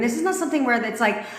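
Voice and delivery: normal talking voice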